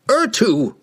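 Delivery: high, loud angry summons; sudden scream. A narrator's reading. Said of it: "he screamed suddenly".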